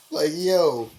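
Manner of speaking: ordinary talk, nothing marked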